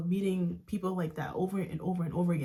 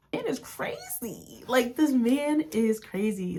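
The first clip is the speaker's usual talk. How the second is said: high-pitched, drawn-out disbelief, building louder; incredulous outrage; 4 semitones higher, 4 dB louder, much slower than usual. Real, not acted. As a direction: exclaim it slowly, utterly appalled. Outraged comment on ex's callous words.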